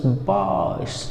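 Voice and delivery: बाश with a long held "aa"; stretched long vowel. Shown as a demonstration. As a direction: draw बाश out as "baaash". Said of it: "थोड़ा सा ज़्यादा खींचेगा".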